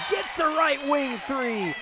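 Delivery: high, drawn-out exclamation falling on "three"; excited scoring call. Real, not acted. Commentator calling a made three, excited and drawn out. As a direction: stretch it out high as the shot drops. Shot goes in, live play-by-play.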